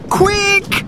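very high, sharp shout; boy's urgent high yell. A narrator's reading. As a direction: shout to hurry everyone up. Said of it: "shouted Robert".

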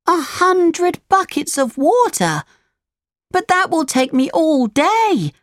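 put-on very high puppet boy protest; Pinocchio, dismayed cry. A narrator's reading. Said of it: "cried Pinocchio".

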